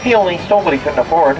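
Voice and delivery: ordinary talk, nothing marked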